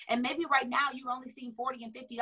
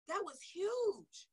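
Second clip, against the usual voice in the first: high, soft and slow, near tears; choked up; 6 semitones higher, 5 dB quieter, much slower than usual. Real, not acted. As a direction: let the voice catch on huge. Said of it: "I'm getting emotional".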